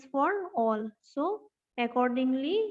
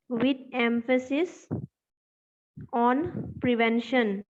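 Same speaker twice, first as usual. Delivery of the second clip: dictation. Real, not acted. Dictates notes, spelling words out.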